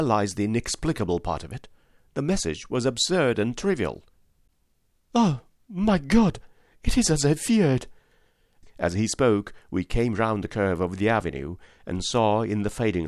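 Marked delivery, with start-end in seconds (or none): 5.1-7.9 anguished cry. Acted. Son sees the house in mourning, anguished. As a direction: let the dread break out of you. Son fears father has died.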